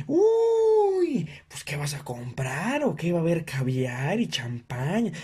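high scoffing uy, slow sarcastic drawl; mock snooty coworker. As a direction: scoff at the price. Coworkers complaining about posada fee. (compañero quejándose del costo, sarcástico)

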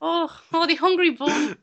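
high, loud hammed-up Irish lilt; over-the-top fake Irish accent; crap Irish accent. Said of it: "put on our crap Irish accents".

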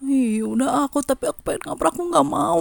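put-on high, protesting kid's whine; mock whining. Student left behind by classmates, imitated high whine. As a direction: whine it as a retold sulky protest.

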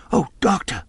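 high, airy plea for help; anxious cry. A narrator's reading. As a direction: cry out to the doctor in distress. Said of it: "we cried".

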